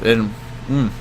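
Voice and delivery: soft, queasy hum of disgust. Real, not acted. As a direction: trail off as if about to gag. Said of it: "makes me gag thinking about it".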